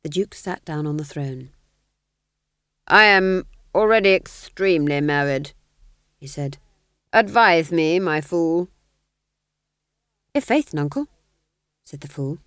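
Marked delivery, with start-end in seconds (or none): 2.9-5.5 louder, clipped aristocratic command; cold Duke. Acted. Duke dismissing the sergeant: louder cold command. 7.1-8.7 louder, clipped aristocratic command; cold Duke. Acted. Duke dismissing the sergeant: louder cold command. 10.3-11.1 higher, eager jester patter; jester fool. Acted. Fool replying to the Duke in jester talk, higher.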